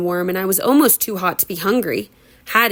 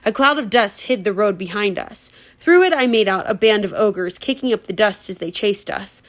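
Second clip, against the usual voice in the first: plain reading voice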